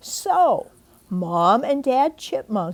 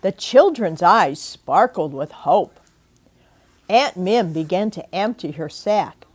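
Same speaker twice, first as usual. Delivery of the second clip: usual speaking voice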